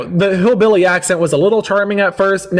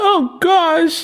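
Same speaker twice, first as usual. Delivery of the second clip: mock shock; 10 semitones higher than usual.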